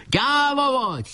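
very high, drawn-out jeer; shouted taunt. A narrator's reading. Enemy chief taunting the leader he chases, shouting. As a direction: stretch the insult out high and slow. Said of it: "kiáltott rá".

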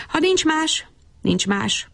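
high musing start, settling into resolve; resolved. A narrator's reading. Bab Berci deciding whom to ask, with resolve. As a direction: make up your mind as you say it. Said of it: "gondolta nagy elhatározással".

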